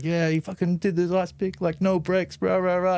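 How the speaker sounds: high, hyped-up retelling of the trick; excited hype. Retold or imitated. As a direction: pump it up like a fan reliving the trick. [name] hyping the ice pick.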